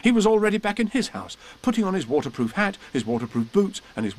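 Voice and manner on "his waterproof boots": plain reading voice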